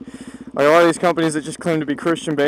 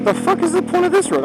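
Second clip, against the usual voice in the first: exasperated; 12 semitones higher than usual. Real, not acted. Annoyed at a strange road.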